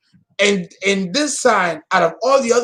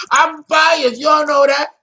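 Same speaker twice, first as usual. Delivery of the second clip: playful exclaim; 9 semitones higher, 4 dB louder than usual. Acted. Confesses bias to viewers, playfully.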